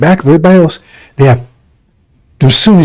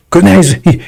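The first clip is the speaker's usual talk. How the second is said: ordinary talk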